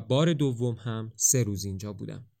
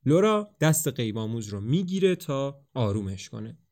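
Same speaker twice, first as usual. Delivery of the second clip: plain reading voice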